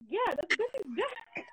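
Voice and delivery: high, slow, mock insisting; hammed-up cousin voice. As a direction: stretch it out high, he's sure of it. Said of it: "He was like".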